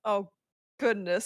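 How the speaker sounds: ordinary talk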